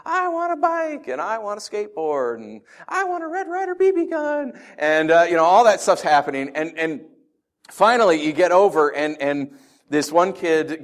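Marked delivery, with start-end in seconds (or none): 0.0-4.6 put-on child's wish list. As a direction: list toys like an eager little child. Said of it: "kids from the community are around them and talking".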